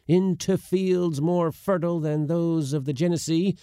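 indignant oration. A narrator's reading. Uncle offering to put his nephew ashore, indignant. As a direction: build a pompous ultimatum, phrase by phrase.

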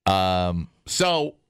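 normal talking voice

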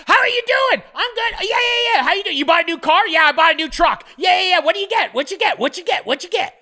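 high, rapid-fire mock catch-up; hyped-up small talk. Mocking their empty catch-up chat, high frantic chatter. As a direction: imitate two over-eager friends firing questions. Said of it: "And we're just like".

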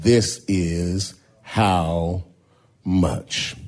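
"This is how much" slow, "much" set apart and falling; dramatic title. Shown as a demonstration. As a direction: stretch "This is how", then land on "much". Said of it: "tag this text with this title".

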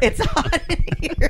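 laughing. Real, not acted. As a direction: laugh through the words. Laughs while sweating.

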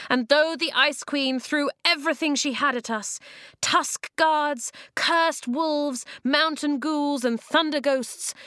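rally the tribes, voice up high; rousing speech. Acted.

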